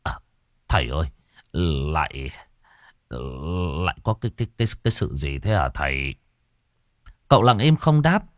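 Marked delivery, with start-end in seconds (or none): 0.0-6.1 low, hushed, halting stammer; scared old man faltering over his words; pale with fright. A narrator's reading. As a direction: stammer it quietly to the master. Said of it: "Cụ Mốc tái mặt".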